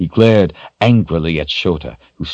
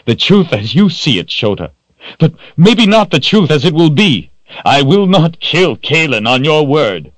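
loud, high-pitched refusal; angry defiance; 11 semitones higher, 6 dB louder than usual. A narrator's reading. Richard refuses to kill on Shota's word, angry defiance. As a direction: throw it back forcefully.

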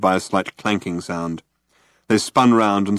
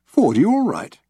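worried; 10 semitones higher than usual. A narrator's reading. Arthur worried.

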